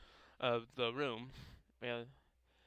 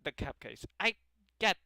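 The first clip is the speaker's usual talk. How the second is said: put-on higher, louder anime-girl character voice; Osana; 5 semitones higher, 5 dB louder than usual. Eager club girl telling friends about her cupcakes, raised character voice. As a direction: voice a game girl, pitched up and punchy. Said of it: "like, Osana's voice from Yandere Simulator".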